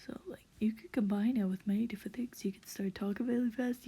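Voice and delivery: fast-talking masculine voice; quick, hurried pace in the put-on voice. Shown as a demonstration. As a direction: rattle it off quickly. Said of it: "I'm just talking faster".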